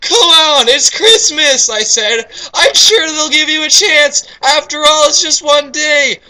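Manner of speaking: high, loud, slow pleading voice; coaxing. Acted. Reader as narrator urging the stranger, high and slow. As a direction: coax him gently but urgently.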